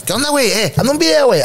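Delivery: high, rough Monterrey greeting; high brash Monterrey voice; Monterrey slang. Retold or imitated. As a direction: call out like a brash guy from Monterrey. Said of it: "como decimos aquí en Monterrey".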